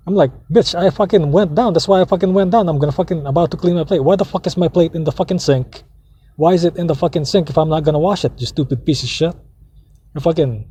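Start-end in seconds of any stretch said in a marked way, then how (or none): 0.5-9.3 heated rant, pitched higher; retold cussing out. Nephew cussing out his aunt over the sink, heated retelling. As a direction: re-enact telling her off, indignant and high. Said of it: "I cussed her out".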